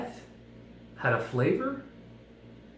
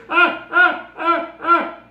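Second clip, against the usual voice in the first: squeaky little squeal, much higher and louder; tofu squeal; 13 semitones higher, 7 dB louder, much slower than usual. Acted. Squeezed tofu squealing at the speaker, high squeaks. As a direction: let out tiny pained squeaks as the tofu.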